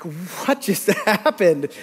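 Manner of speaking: slow, drawn-out disbelief; stunned police officer. Retold or imitated. Police officer who watched the whole chaos, stunned. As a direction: stretch it out, dumbfounded, with a touch of laughter. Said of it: "he was like this, going".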